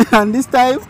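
ordinary talk, nothing marked

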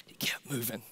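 soft unvoiced whisper. Real, not acted. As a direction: hush it as a reverent secret. Hushed climax of sermon.

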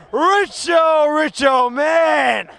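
chanting the name happily; excited cheer. Real, not acted. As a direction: celebrate the goal. Celebrating the goal.

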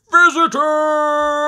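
high, loud, drawn-out whoop; exclaiming. A narrator's reading. Grampy Rabbit greets rare visitors, delighted exclamation. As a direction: cry it out in delight. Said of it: "exclaims Grampy Rabbit".

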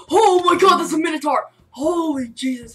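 high, loud shriek of fright; jump-scare yell; scared scream. Real, not acted. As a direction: shriek as the monster appears. Minotaur jumps out, scared.

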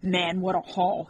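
usual speaking voice

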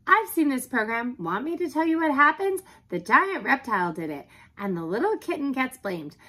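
high, loud, eager spoiler-telling; chatty talking dog. Acted. Dog talks through the favorite TV show, chattering.

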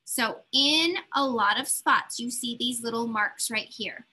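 ordinary talk, nothing marked

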